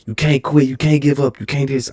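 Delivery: lightly voiced hype man urging him not to quit. [name] urging him through the workout, mock coach.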